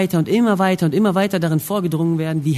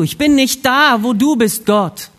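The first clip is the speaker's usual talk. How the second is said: high, loud, emphatic cry to God; loud confession; 6 semitones higher, 5 dB louder, slower than usual. Acted. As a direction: call it out slowly to God. Adams Erkenntnis, Gott zugerufen. (laut und eindringlich bekennend)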